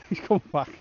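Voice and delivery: laughing through the words; amused chuckle as the dog returns. Real, not acted. Angler laughing as the puppy runs back to him.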